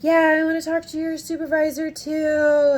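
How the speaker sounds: high, loud, chirpy request; retold eager insistence. Customer asking for the next supervisor up, eager. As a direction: pitch it up and push it cheerfully.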